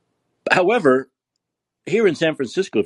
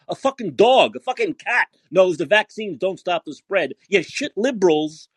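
normal talking voice

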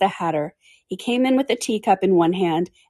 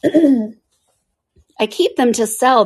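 Hatter's voice; 6 semitones higher, 4 dB louder, much slower than usual. Acted. The Hatter explains himself.

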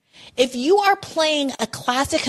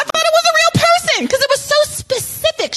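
excited agreement. Real, not acted.

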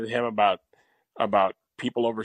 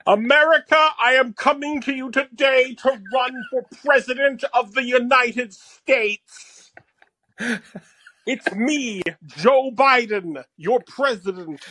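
higher, louder, slow grand speech; Joe Biden voice; 10 semitones higher, 4 dB louder, slower than usual. Pretend. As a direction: announce it like a campaign launch. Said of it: "Here, I'll do Joe Biden".

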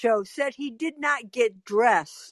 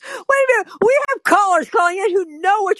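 high, loud and eager; excited; 9 semitones higher, 5 dB louder than usual. Real, not acted. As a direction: burst in excitedly. Bursts in, high.

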